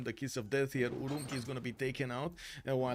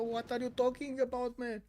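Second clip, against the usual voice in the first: much higher "what are you talking about"; mock bewildered; 11 semitones higher than usual. Streamer mocking his confusion at the girls' accents, much higher. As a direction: play the baffled listener. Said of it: "I was barely under the".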